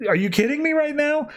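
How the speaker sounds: high, incredulous outburst; can't believe what she's hearing; exasperated disbelief. Retold or imitated. Helena fed up with Batman's rule, high and incredulous. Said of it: "And it's like".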